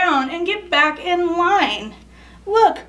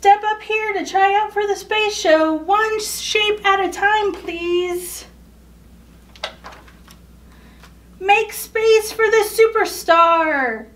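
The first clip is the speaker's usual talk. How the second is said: a hint of an eager show director, voiced higher; director calling out; 6 semitones higher than usual. Show director summoning shapes to audition, lightly voiced higher. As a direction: call the next shape up.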